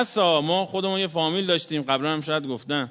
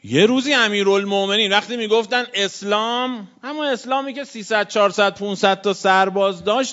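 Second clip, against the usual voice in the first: rising preaching cry; 7 semitones higher than usual. Acted. Preacher extolling early Islam to mourners, high impassioned cry. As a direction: lift the congregation with it. Preacher building sermon climax.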